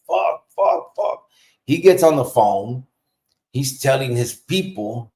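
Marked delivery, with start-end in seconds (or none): 0.1-1.3 breathless whispered swearing through pain; hissing curses while hurting. Retold or imitated. Whispering curses to himself, in pain in the van.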